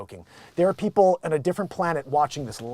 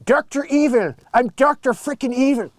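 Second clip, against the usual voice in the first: Doctor Evil; 7 semitones higher, 5 dB louder than usual. Pretend.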